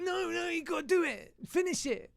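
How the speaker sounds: hammed-up pushy brain voice; insistent inner voice. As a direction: nag yourself in a high squeaky voice.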